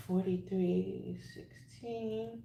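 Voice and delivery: very soft, slow repeat; murmured to oneself. Real, not acted. Reader repeats a number to herself, murmured very softly. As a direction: repeat it slowly under your breath.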